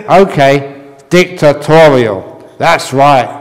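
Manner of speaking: mild mock venting. A narrator's reading. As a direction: let off steam at him. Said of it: "Breath vented".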